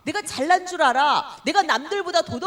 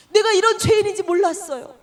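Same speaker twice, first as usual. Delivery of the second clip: loud, broken confession to God, 이런 hit hard; a sinner crying out to God, loud and anguished; 6 semitones higher, 8 dB louder than usual. Acted. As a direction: cry it out to God as a stricken sinner.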